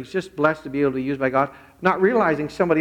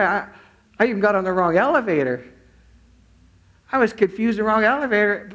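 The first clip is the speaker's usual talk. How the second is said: higher-pitched retold amazement; blown away; 6 semitones higher than usual. Man realizes his elevator mistake was meant, amazed. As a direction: marvel at it as the astonished man. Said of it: "then he was blown away".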